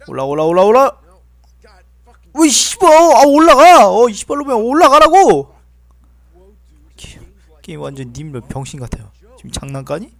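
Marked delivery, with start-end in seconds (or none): 0.1-5.5 building from urging to screaming; furious yelling. Real, not acted. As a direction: scream at the character to climb. Urging character up.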